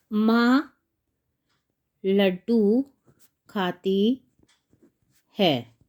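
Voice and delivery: isolated words with long gaps; slow dictation. Shown as a demonstration. Teacher writing the mother's sentence, slow dictation.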